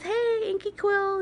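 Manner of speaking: high, slow sing-song greeting; imitating a youtuber's intro. Imitating Inky Quill's video intro, high and drawn out. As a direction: stretch out the hey like the host does. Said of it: "she says".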